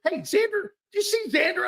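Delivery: ordinary delivery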